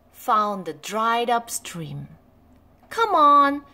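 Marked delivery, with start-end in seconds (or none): 2.9-3.6 loud call up the gully; bright loud call. A narrator's reading. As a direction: call to a friend nearby. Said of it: "called Chip".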